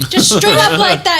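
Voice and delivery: normal talking voice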